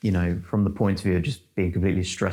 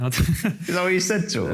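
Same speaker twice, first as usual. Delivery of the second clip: surprised; 9 semitones higher than usual. Real, not acted. Incredulous laughing question.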